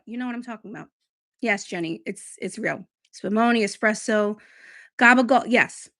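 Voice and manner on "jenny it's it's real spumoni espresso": usual speaking voice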